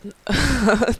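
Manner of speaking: short burst of laughter; amused laugh. Real, not acted. Streamer explaining she is live, amused laugh. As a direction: laugh it off briefly.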